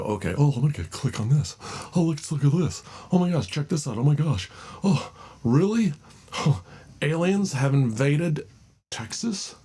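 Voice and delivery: high, gushing mock amazement; mock clickbait reader. Vlogger mocking news readers, gushing fake amazement. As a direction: gasp over every headline like a gullible reader. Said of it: "you sit there and you go".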